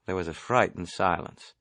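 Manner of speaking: ordinary delivery, nothing marked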